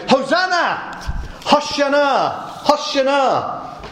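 drawn-out, high ringing cries of Hosanna; crowd shouting Hosanna. Retold or imitated. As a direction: call it out like a cheering crowd. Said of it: "They shouted".

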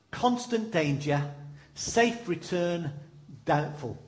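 slow and measured, each phrase weighed; slow grave reading. Shown as a demonstration. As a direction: read the advert like a sombre notice. Reading old advert slowly.

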